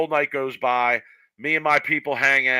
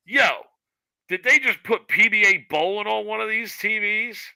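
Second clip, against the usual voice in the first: mock big-dude voice pitched much higher; imitating the big dude; 9 semitones higher than usual. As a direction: do the big guy at the bar, hammed up. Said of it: "the big dude say".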